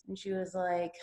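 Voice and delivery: normal talking voice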